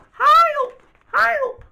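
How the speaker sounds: very high, loud squeal; cry for help. Acted. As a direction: shriek for rescue in storybook panic. Acted cry for help in story.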